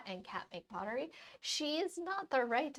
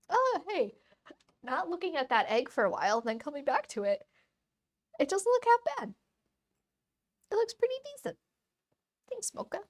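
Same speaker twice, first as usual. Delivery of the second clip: much higher and louder, pleased with the egg; light up at how good it turned out; delighted surprise; 7 semitones higher, 5 dB louder than usual. Real, not acted. Excited about her painted egg.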